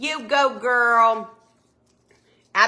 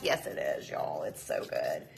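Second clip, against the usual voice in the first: whispered aside; 9 dB quieter than usual. Real, not acted. Whispered aside about dessert.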